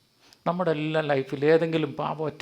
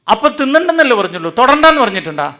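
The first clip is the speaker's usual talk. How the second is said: loud, high, coaxing tempter; tempting serpent; 7 semitones higher, 11 dB louder, faster than usual. Acted. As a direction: egg her on to break the rule. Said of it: "ഇവന്മാര് പറഞ്ഞ dialogue പറയാം". (പ്രലോഭിപ്പിക്കുന്ന സർപ്പത്തിന്റെ ശബ്ദം)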